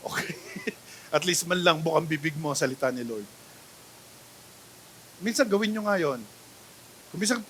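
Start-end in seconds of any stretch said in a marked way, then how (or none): none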